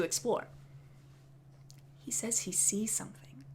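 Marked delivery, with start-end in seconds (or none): 2.0-3.4 soft whispered storytelling; spooky whisper. Real, not acted. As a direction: hush it like a ghost story.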